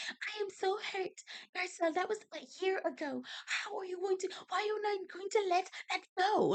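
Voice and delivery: high, soft, put-on prissy voice; mocking Dorit's accent. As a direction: mimic her fake accent, wounded. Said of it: "In whatever accent she decided to use".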